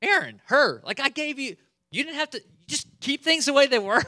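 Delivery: high, loud exasperated scolding; a frustrated leader scolding his brother; mock angry Moses. Moses scolding Aaron, high exasperated rant. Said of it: "He's like".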